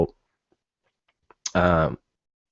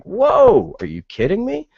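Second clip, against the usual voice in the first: incredulous; 10 semitones higher, 3 dB louder than usual. Real, not acted. Outraged disbelief at police report.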